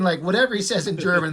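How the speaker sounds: ordinary talk, nothing marked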